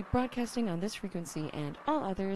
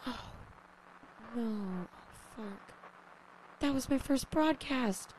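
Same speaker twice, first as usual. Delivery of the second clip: normal talking voice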